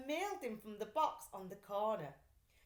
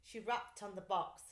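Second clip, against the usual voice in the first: ordinary delivery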